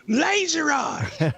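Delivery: laughing shout. Pretend. Shouting a game move, laughing.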